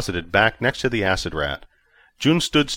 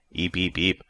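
squeaky little creature chirps; small creature squeak; 4 dB quieter than usual. A narrator's reading. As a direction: chirp it like a tiny alien pet.

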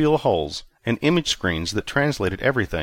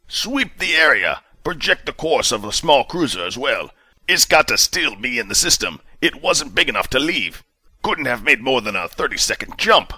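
a hint of a stern sheriff, higher and louder; clipped commanding officer tone; hint of a stern commander demanding answers; commander Justice, lightly voiced; 5 semitones higher, 4 dB louder than usual. A narrator's reading. Commander orders.

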